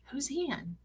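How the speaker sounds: hushed curious question. Retold or imitated. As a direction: ask it under your breath, intrigued. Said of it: "everybody's like".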